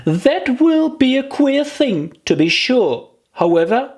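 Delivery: put-on high childlike voice, a little worried; a little girl scolding herself; high little girl, rueful; Alice, rueful. A narrator's reading. Alice worries while swimming.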